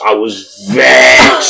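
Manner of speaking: very slow, stretched; stretched indignation; drawn-out vexed. Real, not acted. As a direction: drag the word out.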